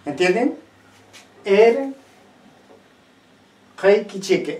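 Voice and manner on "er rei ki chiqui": word demonstration. Shown as a demonstration. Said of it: "Esta palabra".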